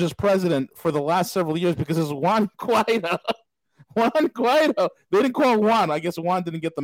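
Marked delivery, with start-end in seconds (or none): 2.1-4.9 laughing through the name, rising higher on the repeat; amused laughing. Real, not acted. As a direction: crack up at the name. Laughing while mocking the name.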